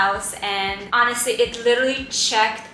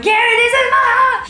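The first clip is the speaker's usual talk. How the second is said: much higher, loud anguished exclaim; desperate cry; 13 semitones higher, 9 dB louder than usual. Retold or imitated. Wife crying to her husband about the stalled house, high and loud. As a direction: plead dramatically.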